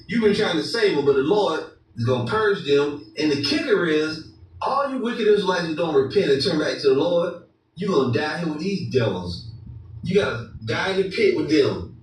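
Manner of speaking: usual speaking voice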